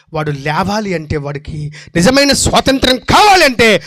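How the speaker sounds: ordinary talk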